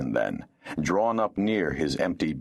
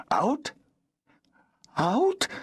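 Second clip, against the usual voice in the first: much higher, quavering disbelief; a frightened woman echoing the word. Acted. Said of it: "the woman's voice quavered".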